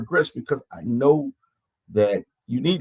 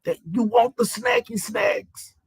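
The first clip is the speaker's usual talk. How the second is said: mock taunt. Mocking Andrea's craving.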